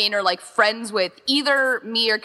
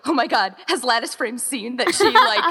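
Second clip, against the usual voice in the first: giggly gossip; 7 semitones higher than usual. Retold or imitated. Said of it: "and we're like".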